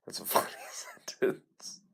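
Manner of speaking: slow whisper with a laugh. Shown as a demonstration. Repeats the line, then whispers it.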